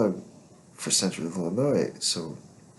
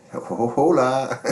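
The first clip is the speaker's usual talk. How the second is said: drawn-out, higher and louder hola, hammed up; mock Spanish greeting; 7 semitones higher, 8 dB louder, slower than usual. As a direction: stretch a jokey hola. Mock greeting of Mexican smugglers.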